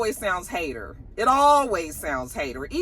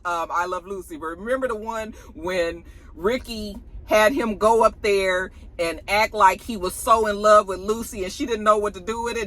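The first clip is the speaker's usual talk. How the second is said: ordinary talk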